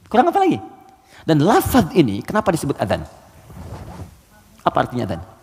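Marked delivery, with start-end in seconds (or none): 0.1-0.6 "Kurang apa lagi?" thrown up high and pressing; higher emphatic repeat. Shown as a demonstration. Preacher pressing the stubborn unbelievers, high and exasperated. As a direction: repeat it, pitch leaping up in exasperation.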